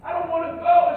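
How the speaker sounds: loud, high imitation of a pleading child; retold screaming plea. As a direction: act out a little girl begging to stay. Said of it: "she's crying and screaming".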